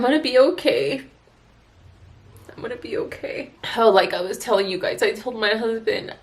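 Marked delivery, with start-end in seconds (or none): 2.5-3.5 breathless whisper of self-comfort; whispered to herself. Real, not acted. Repeating it to herself after crying, in a whisper. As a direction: barely voice it, just to yourself.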